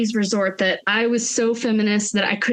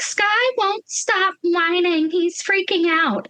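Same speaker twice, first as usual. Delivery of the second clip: mock panicked mom; 7 semitones higher, slower than usual. Imitating his mother on phone.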